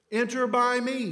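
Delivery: "Enter by me" pushed up and loud; emphatic. Acted. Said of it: "The Greek is emphatic".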